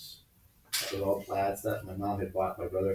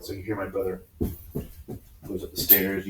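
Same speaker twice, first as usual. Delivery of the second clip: ordinary delivery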